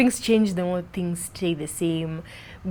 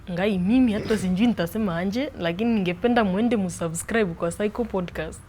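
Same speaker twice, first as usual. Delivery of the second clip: Swahili in a put-on Meru accent. Shown as a demonstration. Asked to plug the YouTube channel, put-on Meru accent. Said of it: "Using the Meru accent".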